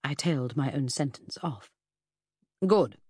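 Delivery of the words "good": curt and loud Good; abrupt. A narrator's reading. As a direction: cut it off sharply. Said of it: "he said so abruptly".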